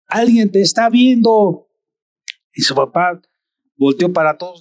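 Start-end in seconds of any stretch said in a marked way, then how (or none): 0.1-1.7 a lightly voiced little boy; child's voice. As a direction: son warning his dad he is being watched, childlike. Child warns father in story.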